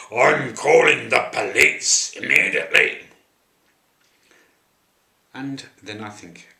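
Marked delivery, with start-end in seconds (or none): 0.1-3.1 booming warning yell; loud shout. Retold or imitated. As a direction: bellow it across the street. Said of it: "shout very, very loudly".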